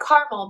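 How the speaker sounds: caramel said as "CAR-mel"; two-syllable caramel. Retold or imitated. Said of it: "if you say caramel".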